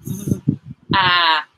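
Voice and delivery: "Th-" then "at", split apart; sound by sound. Shown as a demonstration. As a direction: push out "Th-" and "at" separately. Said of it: "we forgot to do the pushing".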